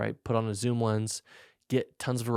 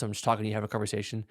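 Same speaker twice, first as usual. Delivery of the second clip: normal talking voice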